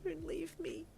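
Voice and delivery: high, soft, anguished plea; anguished whisper. Acted. Patient begging a partner to stay, anguished. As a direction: plead quietly in anguish. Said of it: "you whispered in an anguished voice".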